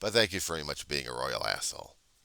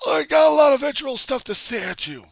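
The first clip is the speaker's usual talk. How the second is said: much higher, much louder wailing heckler voice; screeching heckler; 14 dB louder than usual. Acted. Heckler threatening a rant at him, mock wail.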